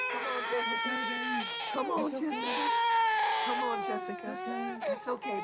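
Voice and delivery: very high, crying tender urging; tearful coaxing. Real, not acted. Rescuer comforting the baby as she comes free, tearful. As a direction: cry while comforting.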